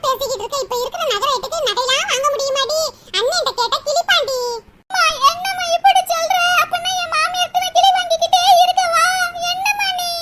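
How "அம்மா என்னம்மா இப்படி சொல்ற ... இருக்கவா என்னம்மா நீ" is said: high, tearful daughter's wail; tearful whining. Acted. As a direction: whine to mother as if crying. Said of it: "அழுகாத மக்கா".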